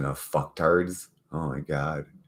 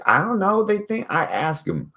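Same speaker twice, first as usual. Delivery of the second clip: high aside; 3 dB louder than usual. Real, not acted. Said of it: "high pitched aside".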